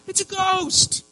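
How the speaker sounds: very high, loud yelp of fright; terrified cry. Acted. Preacher as disciples mistaking Jesus, frightened cry. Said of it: "and they're crying out".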